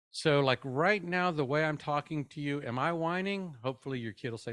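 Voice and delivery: ordinary talk, nothing marked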